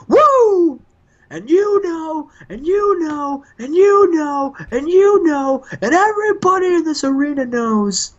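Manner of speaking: strut and boast like a wrestling legend cutting a promo; Ric Flair impression. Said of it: "And Ric Flair's like".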